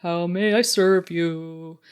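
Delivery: singsong mindless robot; chirpy servant robot voice. Pretend. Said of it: "just singing in a robot voice".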